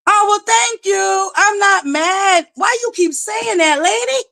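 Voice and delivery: high, slow, sugary sarcastic denial. Real, not acted. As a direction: deny it sweetly, up high. High sarcastic denial of being mad.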